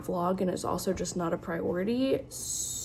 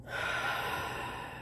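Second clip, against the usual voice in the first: long weary sigh; 9 dB quieter than usual. Real, not acted. Weary sigh about the book.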